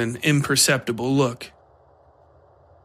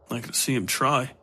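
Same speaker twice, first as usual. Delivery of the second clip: softer, under his breath; sulky mumble looking down; mumbled; 5 dB quieter than usual. A narrator's reading. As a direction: mutter it at the ground. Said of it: "he mumbled".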